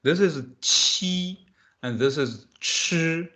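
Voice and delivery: "qi" with the tongue flat and forward, "chi" with the tongue curled back, slowly; slow teacher contrast of two sounds. Shown as a demonstration. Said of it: "Can you hear the difference?".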